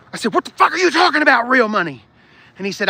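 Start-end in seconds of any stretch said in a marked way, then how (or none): 0.3-2.0 high, loud, fast outburst at dad; angry retort. Retold or imitated. Son yelling back at dad over real money, angry. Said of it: "I was mad at my dad".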